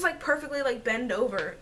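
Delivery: ordinary talk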